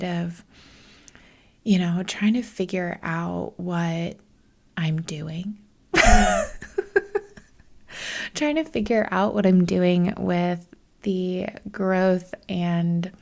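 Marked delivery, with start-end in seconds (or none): 5.9-7.4 sudden high-pitched laugh; real laugh. Real, not acted. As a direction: laugh loud and high at your own situation. Exasperated laugh about her hectic week.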